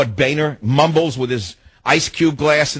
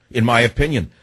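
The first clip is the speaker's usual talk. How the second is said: usual speaking voice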